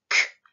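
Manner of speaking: K as a short voiceless burst; isolated sound. Shown as a demonstration. Teacher contrasting two confusable sounds, crisp isolated sound. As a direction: pop out a bare K. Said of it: "la confondre avec le k".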